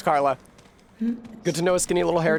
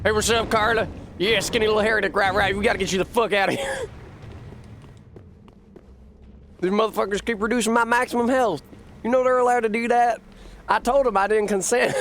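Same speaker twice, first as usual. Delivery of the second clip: put-on Southern prospector accent; 9 semitones higher, faster than usual. Gamer hamming up a Southern prospector accent for the NPC. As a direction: play a grizzled Southern gold prospector. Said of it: "Whenever I do that accent, Cohen says that I sound like a prospector".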